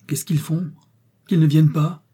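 high, frantic plaint; distraught moan. A narrator's reading. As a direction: plead desperately for help to come. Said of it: "gémit Saurin, éperdu".

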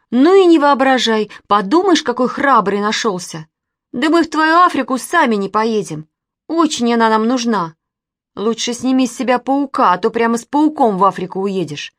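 offended and emphatic; indignant retort. A narrator's reading. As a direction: hurl it back at the show-off. Girl retorts indignantly to boy.

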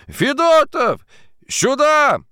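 high, loud, long-drawn summons; yelling for help. A narrator's reading. Drunken timekeeper summoning the watchman, yelling. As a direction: call someone over from across the yard. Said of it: "заорал он вдруг".

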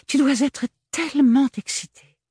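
very high, loud, gushing big sister. Acted. Agathe greeting her little sister, high and gushing. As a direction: sweep in all sugary.

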